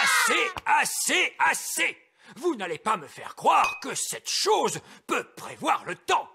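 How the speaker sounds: exasperated shout. Acted. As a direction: shout them down, fed up. Dispute sur la boule à neige. (cri exaspéré, fort et aigu)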